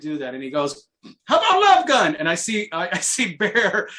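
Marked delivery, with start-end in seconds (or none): 1.2-2.2 high, loud retold suggestion; acted-out excited shout; called-out request. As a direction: throw out the next challenge eagerly. Retelling drummer calling next request.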